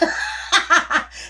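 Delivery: high unvoiced laugh breaking out; burst of laughter. Real, not acted. Telling about mother's swearing, bursting out laughing. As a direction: laugh helplessly at the memory.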